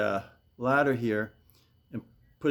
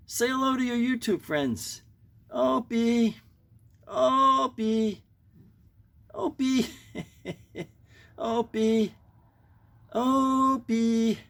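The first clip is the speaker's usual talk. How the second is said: calling the pet; 10 semitones higher, much slower than usual. Real, not acted. Coaxing pet to greet viewers.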